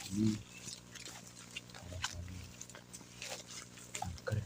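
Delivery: hushed, nervous night talk; nervous night vlog whisper. Real, not acted. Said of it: "saya pelan-pelan ngomongnya".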